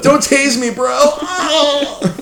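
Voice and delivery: very high yelled plea with a scream; panicked yelp. Retold or imitated. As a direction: cry out as if tased. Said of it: "that famous clip".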